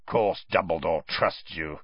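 low growl; Moody. A narrator's reading. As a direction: growl it scornfully, pitched low. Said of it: "growled Moody".

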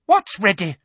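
high, sharp demand; demanding Umbridge. A narrator's reading. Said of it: "demanded Umbridge".

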